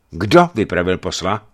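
loud, harsh questioning. A narrator's reading. Archbishop questioning the boyar about the messenger, harsh. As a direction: demand it roughly, with force. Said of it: "zeptal se drsným hlasem vladyka".

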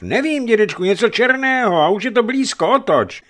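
put-on urgent, very high child's yell; boy shouting harder. A narrator's reading. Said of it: "vykřikl hoch ještě silněji".

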